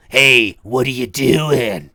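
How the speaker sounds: low, slow, easy phone greeting; a relaxed creepy caller; low calm caller voice. Retold or imitated. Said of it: "you hear him go".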